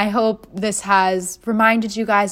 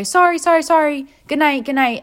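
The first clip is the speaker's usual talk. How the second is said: higher, hurried apologies; mock flustered guards; 7 semitones higher, 4 dB louder than usual. Guards leaving the room, flustered and apologetic. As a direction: back out apologizing fast. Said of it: "they say".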